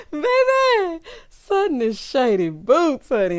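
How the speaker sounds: very high, squeaky after-laugh voice; high voice choked with real laughter. Real, not acted. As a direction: squeal it out, recovering from a laughing fit. Still recovering from laughing fit.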